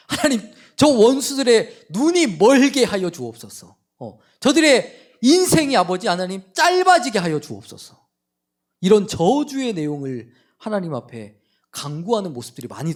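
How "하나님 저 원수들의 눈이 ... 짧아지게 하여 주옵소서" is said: loud, higher imprecatory cry; David crying out against his enemies, loud and bitter; cursing prayer. Retold or imitated. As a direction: hurl curses on your enemies to heaven. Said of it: "다윗도 뭐라고 고백을 하냐면".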